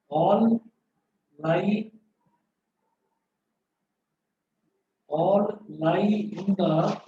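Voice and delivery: slow dictation, word groups split by long pauses; classroom dictation. Real, not acted. Teacher dictating a physics rule to students, slow dictation. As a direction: dictate slowly so students can write.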